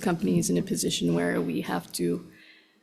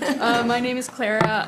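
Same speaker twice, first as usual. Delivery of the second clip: nervous laugh; 5 semitones higher, 3 dB louder than usual. Real, not acted. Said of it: "a little nervous".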